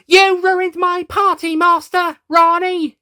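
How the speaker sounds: very high, loud, slow enraged shriek; raging doctor screaming at the villains; furious scream. Acted. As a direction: scream it at the top of your lungs. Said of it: "she screamed at the top of her lungs".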